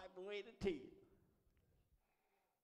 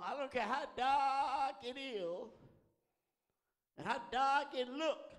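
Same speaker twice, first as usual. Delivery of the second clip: loud, higher, drawn-out preaching cry; impassioned preaching; 4 semitones higher, 7 dB louder than usual. Real, not acted. Preacher urging congregation through dark days, loud and drawn out. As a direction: swell up and stretch the key words.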